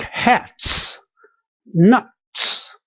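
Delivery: "hats" and "nuts" with the final ts set apart; hissed ts closing "hats" and "nuts"; stressed final ts. Shown as a demonstration. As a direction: draw out the ts ending "hats", "nuts". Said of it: "these are ts".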